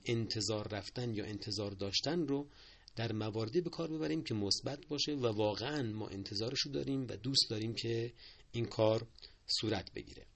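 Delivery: normal talking voice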